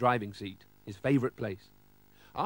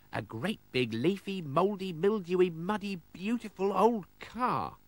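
excited announcement; 7 semitones higher than usual. Acted. Boy showing the old car he found, excited rising call. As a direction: pile up the words, climbing in pitch. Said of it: "he shouted".

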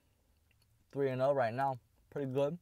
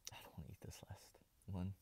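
soft unvoiced ASMR whisper; 3 semitones lower, 19 dB quieter than usual. Real, not acted. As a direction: breathe the words, no voice. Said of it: "thank you guys for watching my A S M R".

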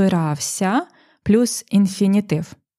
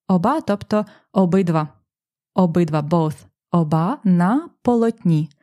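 normal talking voice

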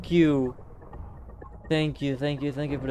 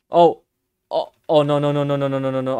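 panicked no no no; 9 dB louder than usual. Real, not acted. Monster closing in.

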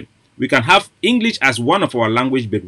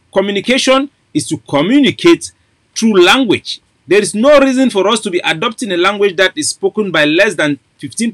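higher, driving orator's push; impassioned rally speech; 8 semitones higher than usual. Acted. Activist urging listeners to drop English, impassioned rally preaching. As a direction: preach it to the crowd with fire. Rising into fiery advocacy.

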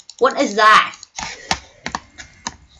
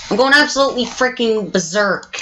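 usual speaking voice

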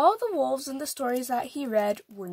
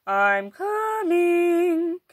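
louder, stretched call to the door; 5 semitones higher, 5 dB louder, much slower than usual. A narrator's reading. Wolf answers the knock, calling out slowly. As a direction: call it long and slow toward the door. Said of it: "Wolf called".

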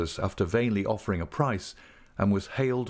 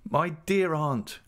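higher, drawn-out exclamation of surprise; delighted cry; 8 semitones higher, slower than usual. A narrator's reading. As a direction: greet her slowly, in pleased astonishment. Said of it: "cried I".

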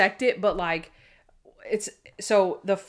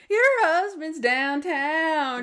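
high, slow, drawn-out bad acting; hammed-up line, stretching the words; mock stage outburst; 5 semitones higher, slower than usual. Monk bursting out after whispering, hammed-up bad acting. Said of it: "'cause he's been whispering, right".

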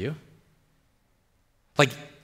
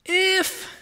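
very high, dangling "if"; doubting "iffing". Acted. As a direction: push "if" right up high, sceptically. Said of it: "don't you always hate it when somebody's".

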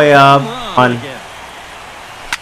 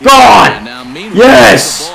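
excited cheer; 3 semitones higher than usual. Real, not acted. Gamer cheering a homer. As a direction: celebrate the shot. Home run celebration.

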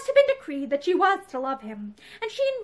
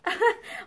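short light chuckle in character; acted laugh; 6 semitones higher than usual. A narrator's reading. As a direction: give a brief acted laugh. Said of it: "Ramsden laughed".

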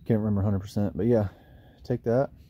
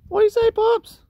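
puppy talk; 8 dB louder than usual. Real, not acted. Owner calling a puppy over, in squeaky high baby talk.

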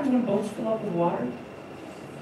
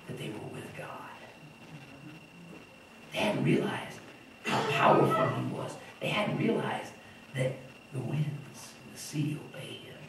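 hushed awe; 6 semitones lower, 10 dB quieter than usual. Real, not acted. Sermon dropping to hushed awe.

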